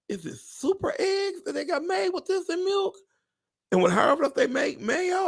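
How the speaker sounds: very high, shrill outrage over mayo price; shocked rant. Retold or imitated. Ranting about the price of mayonnaise, shrill disbelief. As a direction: squeal the questions in disbelief. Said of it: "I was like this".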